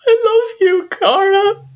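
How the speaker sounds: very high, loud, crying parent; tearful declaration. A narrator's reading. Parent finally speaks to child after silence, tearfully. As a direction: push it out through tears. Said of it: "before you can manage to speak".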